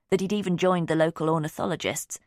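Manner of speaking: usual speaking voice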